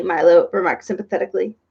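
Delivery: plain reading voice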